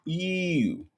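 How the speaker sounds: high-pitched elongated imitation; Nesta. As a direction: stretch it out high and light, mimicking. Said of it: "sound like Nesta when you say you".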